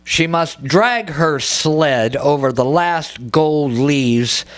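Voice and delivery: slow, measured verse with long pauses; poetry reading. Acted. Poet reading a poem to a bookstore audience, slow and deliberate. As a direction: set each image apart, lingering on the words.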